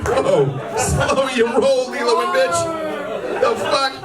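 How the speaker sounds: reacting to the heckler, hammed-up anger; outraged shout. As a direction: shout it, half laughing. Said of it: "It's like".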